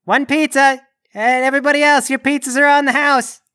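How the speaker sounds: high, projected announcement; calling out. Acted. Cashier announces free pizza to the customers, calling out. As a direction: call it out to the whole shop.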